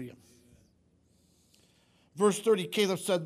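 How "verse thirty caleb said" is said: usual speaking voice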